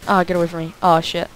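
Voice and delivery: ordinary delivery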